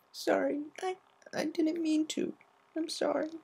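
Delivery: put-on startled child peering out from hiding; Scootaloo, sniffly and startled; teary Scootaloo. Scootaloo apologizing.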